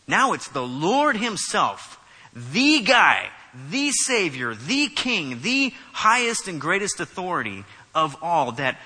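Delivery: loud, punched stress on each title; emphatic preaching. Real, not acted. Preacher exalting the Lord to the congregation, emphatic. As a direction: hammer out each title with force.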